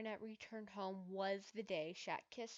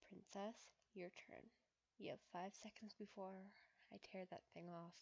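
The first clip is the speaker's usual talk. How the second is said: soft, hushed voiced murmur; whispered; 7 dB quieter than usual. A narrator's reading. Adrian urging Mary to take her turn, hushed murmur. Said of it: "he whispered in her ear".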